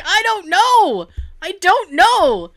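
slow, high, drawn-out words; exaggerated wailing plea; mock helpless protest. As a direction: imitate a flustered cook who has given up. Acted helpless cook under attack.

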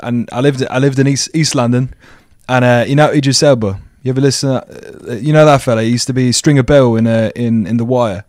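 put-on East London English accent; casual imitation London speech. Shown as a demonstration. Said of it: "I think my English accent's pro".

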